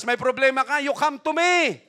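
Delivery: high, loud calling-out shout; preacher voicing Jesus to the congregation, shouting the invitation. Preacher shouting Jesus' call to the troubled, loud and high.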